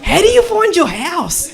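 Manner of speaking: high, loud kid impression; Lebo kid. Lebo kid quizzing blind neighbour, high loud voice. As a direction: pitch it way up, loudly incredulous. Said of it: "Lebo kid down my street".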